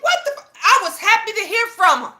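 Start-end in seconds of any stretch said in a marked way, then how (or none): 0.0-0.5 high-pitched, stunned what-the; a sudden squeal of surprise as the caller says who she is; shocked disbelief. Retold or imitated. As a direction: blurt it out in shock. Shocked to learn caller's identity.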